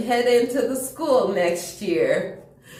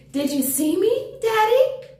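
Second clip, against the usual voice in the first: put-on high, eager little boy calling his dad; a kid proud of himself, voice climbing; eager child; 12 semitones higher than usual. Child calls to his daddy.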